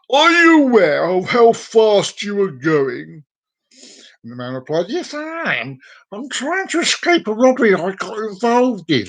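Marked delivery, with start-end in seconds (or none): 0.1-3.2 slow, loud, higher-pitched policeman; stern cop. Acted. Cop questions driver in joke. 4.9-9.1 high-pitched character voice for the driver; driver's put-on voice. Driver replies to cop in joke.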